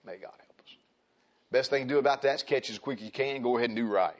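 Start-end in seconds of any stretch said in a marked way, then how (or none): 0.1-0.8 hushed, whispered aside to God; whispered plea. Real, not acted. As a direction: drop to a whisper in prayer. Hushed prayer after loud preaching.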